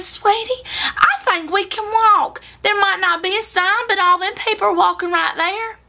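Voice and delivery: high-pitched, excited country wife; imitating a thrilled wife pointing things out; excited little lady. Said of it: "She was so excited, she looked at her husband".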